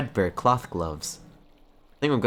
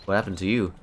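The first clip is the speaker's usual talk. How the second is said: ordinary delivery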